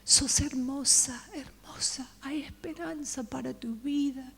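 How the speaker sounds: weep the words softly over her; tearful pleading. Retold or imitated. The preacher, weeping, holds her face and comforts her. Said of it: "llorando así".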